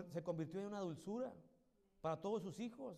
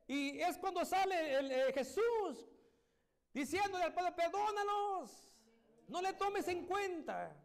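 very slow, much higher anguished "Perdónalos"; Jesus' plea; 8 semitones higher, 3 dB louder, slower than usual. Acted. Jesus begs the Father to forgive, slow and high. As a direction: stretch each word out high in pleading.

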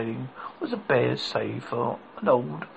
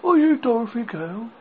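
put-on higher, louder boyish voice; young boy voice; 8 semitones higher, 6 dB louder than usual. A narrator's reading. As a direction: voice the farm boy, eager. Boy character asks Dorothy.

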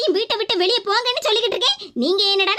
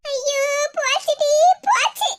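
1 panicked wail; 4 semitones higher, slower than usual. Acted. Daughter panics, caught.

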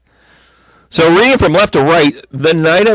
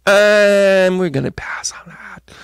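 high, drawn-out, fading brush-off; mock reluctant refusal; 5 semitones higher, slower than usual. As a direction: stretch out an awkward no, trailing away. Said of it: "they're just like".

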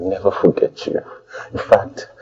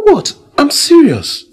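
much higher, loud mock-wounded protest; indignant protest; 7 dB louder, slower than usual. Lover protesting his sincerity to a doubtful girlfriend. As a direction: act hurt that she doubts you.

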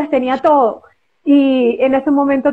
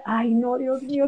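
much softer, worried murmur; fearful hush; 10 dB quieter than usual. Retold or imitated. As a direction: say it under your breath, scared. Stage fright before singing. (susurro asustado)

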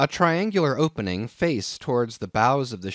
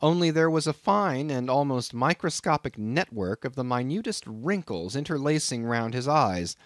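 usual speaking voice

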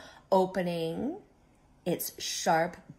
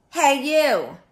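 loud, higher gruff challenge; grouchy shout; 5 semitones higher, 7 dB louder than usual. Acted. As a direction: bark it like a grumpy bug. Grouchy ladybug challenges whale.

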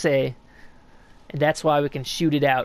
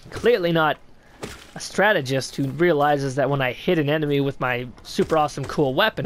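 ordinary talk, nothing marked

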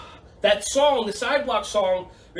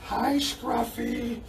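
soft greeting; 4 dB quieter, much slower than usual. Real, not acted. Greeting the dog.

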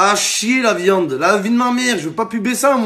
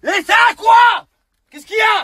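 high, yelled irritation; annoyed high-pitched yell; exasperated shout; 13 semitones higher, 4 dB louder than usual. Acted. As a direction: shout it high, fed up at being bothered. Irritated at interruption.

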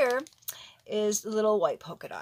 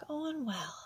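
whispered to herself, a touch higher; 6 semitones higher, 4 dB quieter than usual. Real, not acted. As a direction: breathe it out under your breath. Repeating to herself while working.

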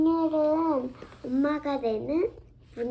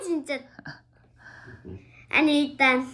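ordinary talk, nothing marked

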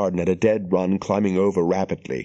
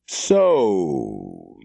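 slurred and soft, lower; soft slurring voice; 5 semitones lower than usual. A narrator's reading. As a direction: slur it softly and low. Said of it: "the slurring voice was as soft".

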